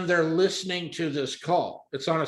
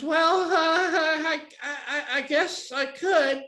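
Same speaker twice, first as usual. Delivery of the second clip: put-on high, stammering girl caller; halting high-pitched mock giggly voice; nervous hesitation; 11 semitones higher, slower than usual. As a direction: imitate a reluctant, uneasy yes. Caller reluctantly agrees.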